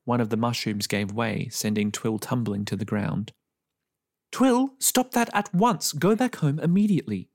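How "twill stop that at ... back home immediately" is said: scolding mother. A narrator's reading. Mother scolding her daughter off the fence, stern high voice. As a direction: scold the child like a cross mother. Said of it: "scolded her mother".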